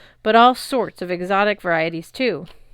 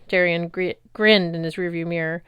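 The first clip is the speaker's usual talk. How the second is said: usual speaking voice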